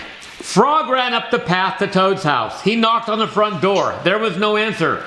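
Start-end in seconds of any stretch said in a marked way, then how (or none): none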